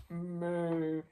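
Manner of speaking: drawn-out imitation cow moo; silly pig's moo. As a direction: give a sustained, level moo. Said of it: "What should the piggy say?".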